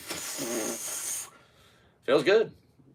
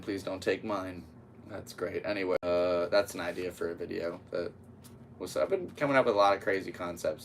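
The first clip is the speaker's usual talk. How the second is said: ordinary talk, nothing marked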